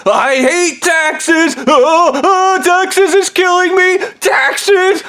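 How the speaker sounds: high, slow, shrieking tantrum; screaming about taxes. Retold or imitated. Ham Man freaking out at his captors about taxes. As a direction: shriek it like a spoiled tycoon losing it. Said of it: "screaming about his taxes".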